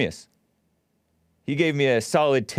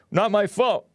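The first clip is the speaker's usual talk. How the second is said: playful; 8 semitones higher than usual. Real, not acted. Joking self-defense.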